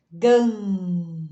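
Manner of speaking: "gan" held on a long nasal vowel; drawn-out demonstrative "gan"; nasal sound. Shown as a demonstration. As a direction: hum out the nasal end of "gan". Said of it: "que também dá som nasal".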